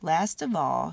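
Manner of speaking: plain reading voice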